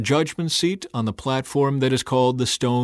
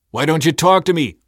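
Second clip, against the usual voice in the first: higher, louder, pressing question; demanding; 6 semitones higher, 7 dB louder than usual. A narrator's reading. Pilate to a silent Jesus, demanding. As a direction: demand an answer, voice raised. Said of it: "Pilate demanded".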